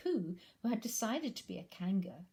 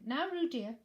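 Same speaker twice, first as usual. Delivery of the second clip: high, louder call to her little one; 7 semitones higher, 6 dB louder than usual. Acted. Kanga calls Roo.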